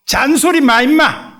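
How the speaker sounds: loud, high gruff snap; gruff rebuke. Retold or imitated. Abraham silencing Isaac's question, loud gruff snap. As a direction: cut the boy off sharply.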